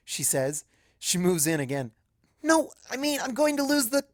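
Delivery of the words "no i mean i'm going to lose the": high, frantic protest; panicked. Acted. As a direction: blurt it out high in a rising panic. Twilight panicking again.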